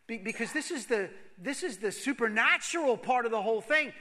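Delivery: loud, high preaching climax; full-force sermon; fervent preaching. Real, not acted. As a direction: build to a rousing peak.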